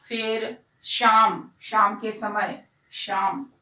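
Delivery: "शाम" stretched very slowly; drawn-out example. Shown as a demonstration. Teacher slowly spelling out a sh word, drawn out. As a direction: draw out "शाम" to let pupils hear the sh. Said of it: "शलगम के अलावा".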